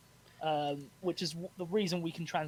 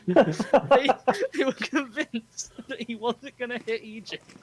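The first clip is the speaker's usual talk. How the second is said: talking through laughter, higher and louder; laughing; 7 semitones higher, 10 dB louder, slower than usual. Real, not acted. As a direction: fight through a laugh to finish the sentence. Cracks up retelling the absurd quote.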